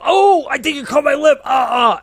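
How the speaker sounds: high, loud fake cry of pain; hammed-up yelp of a dad playing hurt; mock pained yelp. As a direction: pretend she cut your lip, hammed up. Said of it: "and I'd go".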